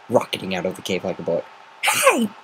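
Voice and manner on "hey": sudden high yelp; yell. Acted. Rainbow Dash yelling after fleeing Gilda. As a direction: call out after her. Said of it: "Rainbow Dash yelled".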